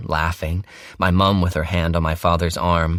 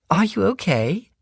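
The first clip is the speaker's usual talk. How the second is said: mother's voice; 14 semitones higher than usual. A narrator's reading. Mother asks.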